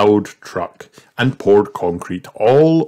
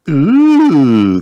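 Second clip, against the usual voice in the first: high, loud delighted ooh; thrilled kids gasping; excited gasp; 8 semitones higher, 7 dB louder than usual. Acted.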